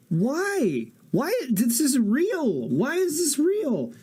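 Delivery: high, drawn-out squeaks of disbelief; can't believe the town is real, voice way up; stunned disbelief. Real, not acted. Streamer reading about a real town, high incredulous whine.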